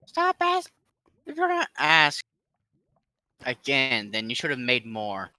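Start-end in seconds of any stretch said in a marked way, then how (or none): none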